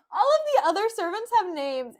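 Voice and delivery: high, loud exclamation; indignant disbelief. Real, not acted. Reader complaining about nameless servant one, loudly indignant. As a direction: burst out in comic outrage.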